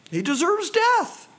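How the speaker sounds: flung out high, a sharp condemning cry; the council pronouncing sentence on Jesus, a high cry; high cried verdict. Acted.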